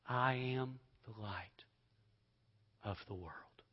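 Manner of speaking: hushed self-affirmation; whispered. Acted. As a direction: say it under your breath. Quiet personal affirmation.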